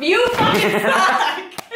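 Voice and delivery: drawn-out, laughing playful jab; mock insult. To the roommate about the lube, a drawn-out laughing mock insult. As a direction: tease her with a slow laughing insult.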